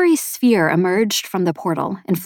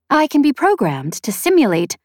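higher-pitched feminine AI; reply as a programmed machine, pitched up; feminine sphere voice; 7 semitones higher than usual. A narrator's reading. Sphere offers its maker simulation, higher feminine AI voice. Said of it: "a feminine voice said".

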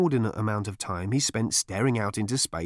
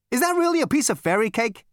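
high, loud brash Zaphod; cocky Zaphod voice; 13 semitones higher, 5 dB louder than usual. Acted. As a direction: play it cool and cocky. Zaphod spots fairy cake.

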